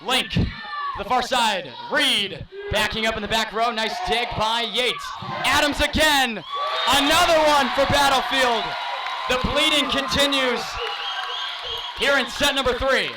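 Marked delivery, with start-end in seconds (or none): 5.4-13.1 excited call. Real, not acted.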